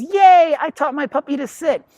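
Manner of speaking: high, loud, gushing yay; mock triumphant owner. Owner cheers at the puppy's accidental sit, high and loud. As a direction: ham up a proud, clueless owner. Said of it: "The human goes".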